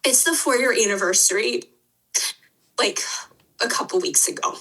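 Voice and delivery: real tears, voice fading at the end; tearful. Real, not acted. Podcast guest explaining the anniversary to her hosts, tearful. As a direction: get it out through tears, trailing into a near whisper. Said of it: "usually don't cry on podcasts".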